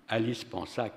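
usual speaking voice

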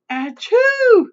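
loud, high-pitched fake sneeze; acted sneeze. A narrator's reading. Sick mother witch sneezes while questioning her daughter, loud fake achoo. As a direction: sneeze mid-sentence, big and high.

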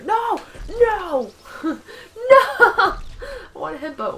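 high loud cries of no, laughing; panicked yelling. Real, not acted. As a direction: yell through laughter. Panic over soccer fail.

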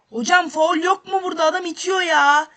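loud, high protest at the referee; furious appeal for a foul; angry shout at ref. Real, not acted. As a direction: yell that it was a foul.

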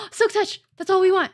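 gasp, then loud high excitement; thrilled. Real, not acted. Gamer landing the Silk Touch trade, thrilled. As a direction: burst out with a delighted find. Finds a prized enchantment trade.

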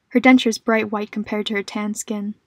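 ordinary delivery

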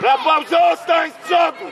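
loud order yelled down the pitch; real shouted order. Real, not acted. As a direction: command him to stay up front. Coach shouting order to player. (głośny rozkaz krzyczany przez boisko)

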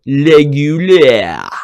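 "Régulier" drawn out slowly in French, rolled ending. Retold or imitated. Host showing how the attendant said regular, French "Régulier". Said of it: "but he said".

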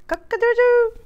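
high, loud crowing cock-a-doodle-doo; loud high rooster call. Acted. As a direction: crow it out like a proud rooster. Rooster crows in story.

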